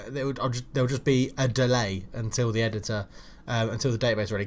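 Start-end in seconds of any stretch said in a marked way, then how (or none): none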